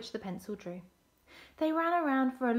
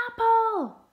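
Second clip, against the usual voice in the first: high, loud demanding yell; full-voiced shout, pitched way up; 12 semitones higher, 12 dB louder than usual. A narrator's reading. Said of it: "yelled Banjo".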